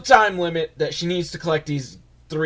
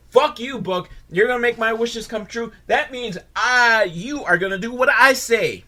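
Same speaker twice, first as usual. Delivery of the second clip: higher, defiant mock scolding of the book; mock bossy retort; 10 semitones higher, 3 dB louder than usual. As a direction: tell the book off, hammed-up.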